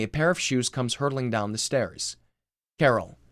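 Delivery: ordinary delivery, nothing marked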